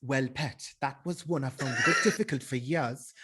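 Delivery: confident Geordie accent; Newcastle accent. Shown as a demonstration. Showing the co-host a mastered Newcastle accent, Geordie. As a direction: talk like a Geordie. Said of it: "Okay, what about Newcastle?".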